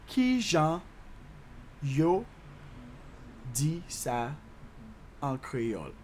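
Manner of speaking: "Ki jan", "yo", "di sa", "an kreyòl" with long gaps; slow breakdown. Shown as a demonstration. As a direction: chunk by chunk, pitch stepping down. Teacher breaks Creole question down.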